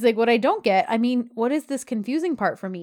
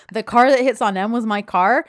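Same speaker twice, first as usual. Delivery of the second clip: louder mocking echo, rising into a question; incredulous sarcastic repeat; 7 dB louder than usual. [name] repeats Yeet's sentence as a question, sarcastic. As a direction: parrot it back loudly, questioning it at the end. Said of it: "[name] repeats back to him".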